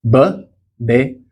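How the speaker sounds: normal talking voice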